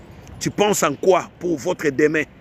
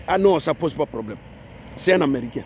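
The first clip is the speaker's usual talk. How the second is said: ordinary delivery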